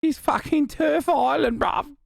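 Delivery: high, slow put-on Brit; mock British accent. Mock Brit commenting on Turf Island, high British accent. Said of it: "I'm doing a British accent".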